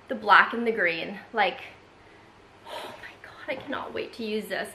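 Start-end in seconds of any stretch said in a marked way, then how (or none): 2.7-4.7 breathy, hushed gush; excited whisper. Real, not acted. Vlogger can't wait to use new bag, excited whisper. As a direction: whisper it, thrilled, almost to yourself.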